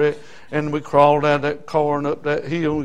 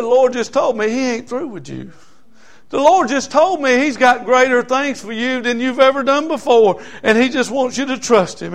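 preached retelling; 8 semitones higher, 5 dB louder than usual. Retold or imitated. Preaching the woman's message.

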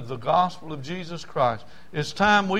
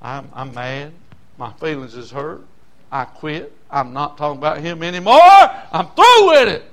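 sulking Jeremiah; 13 dB louder than usual. Retold or imitated. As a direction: play Jeremiah giving up, rising to a cry. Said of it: "Jeremiah said".

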